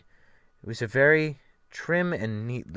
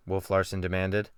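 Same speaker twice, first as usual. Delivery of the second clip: plain reading voice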